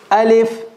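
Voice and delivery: Alif sounded full and clear from the throat, held; teacher models Alif for class to repeat; model letter Alif. Shown as a demonstration. Teacher models Alif, clear and held. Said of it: "I'm going to read each letter a few times".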